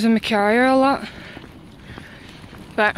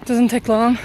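usual speaking voice

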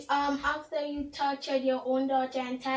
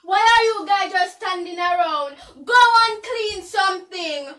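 loud, higher command; bossy shout; 6 semitones higher, 12 dB louder than usual. Acted. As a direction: princess ordering idle servants to work, bossy shout. Princess ordering servants.

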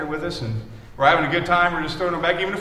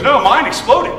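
loud, emphatic retelling of the friend; loud retold friend; 6 semitones higher, 10 dB louder than usual. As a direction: belt out the friend's line. Friend's startling appendix story.

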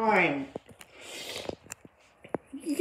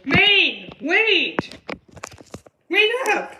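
calling out; 5 semitones higher, 4 dB louder, slower than usual. Acted. Calling after running friend.

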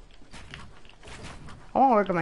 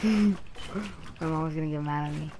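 low, very soft aside; hushed, low-pitched murmur to himself; subdued; 13 semitones lower, 11 dB quieter than usual. Real, not acted. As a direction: keep it down after being scolded. Subdued after mother's scolding.

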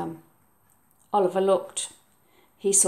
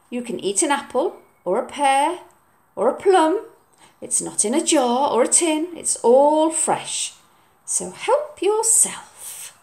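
coaxing mum; 5 semitones higher than usual. Acted. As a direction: tempt him kindly with fruit. Mum's voice.

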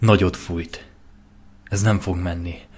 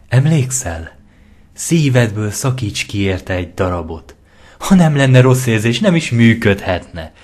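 sly, pushy voice, raised and louder; scheming tempter; 3 semitones higher, 5 dB louder than usual. Acted. Lentó talks Lyra into killing her friend, sly and pushy. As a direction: coax her toward the knife.

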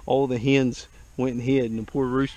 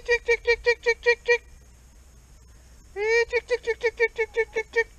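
chicken call. Real, not acted.

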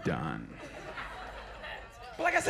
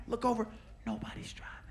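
hushed whisper of disbelief; 2 semitones higher, 10 dB quieter than usual. Retold or imitated. Comic telling a crowd, whispered in awe. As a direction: whisper it like the car might hear. Whispered aside about driverless car.

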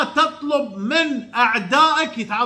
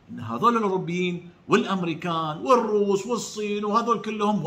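ordinary talk

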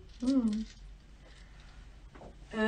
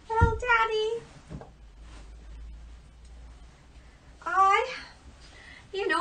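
put-on higher, gushing girlish voice; eager eldest daughter; 4 semitones higher, 5 dB louder than usual. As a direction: flatter Daddy with a breathless rush. Eldest daughter answers the king.

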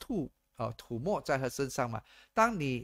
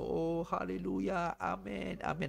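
mock worship; 6 semitones higher, 4 dB quieter than usual. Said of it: "然后在那边笑啊笑".

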